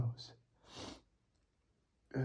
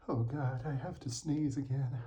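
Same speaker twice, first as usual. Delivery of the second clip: ordinary delivery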